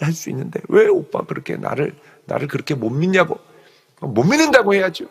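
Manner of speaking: ordinary talk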